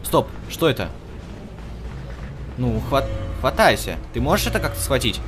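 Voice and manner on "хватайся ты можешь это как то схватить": ordinary delivery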